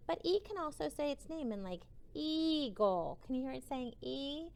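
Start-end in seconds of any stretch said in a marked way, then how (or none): none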